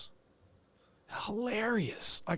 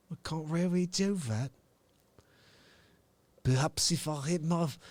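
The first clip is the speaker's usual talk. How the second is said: English accent attempt. Shown as a demonstration. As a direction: ease into a tentative English accent. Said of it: "I can't do an English accent".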